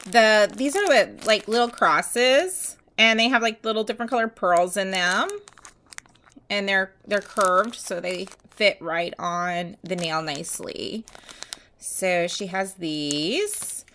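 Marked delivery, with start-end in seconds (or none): none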